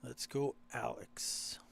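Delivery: whispered name Alex. Real, not acted. Whispered cheer for a player.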